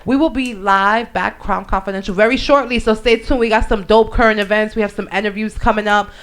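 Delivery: upbeat host sign-off; lively radio host voice; radio show outro. Acted. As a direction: sell the show to listeners.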